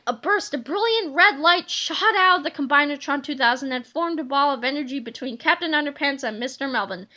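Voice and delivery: ordinary delivery, nothing marked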